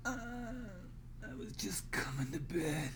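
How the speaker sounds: faint, whispered and wounded; weak voice. Acted. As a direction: barely get the words out. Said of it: "he says in a weak voice".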